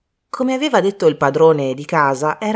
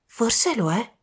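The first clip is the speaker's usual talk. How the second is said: softer, higher, tentative hope; hopeful; 5 semitones higher, 4 dB quieter, slower than usual. Acted. Bridget hopes the ruby is real, hopefully. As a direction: suggest it gently, hoping it's true. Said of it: "disse Bridget speranzosa".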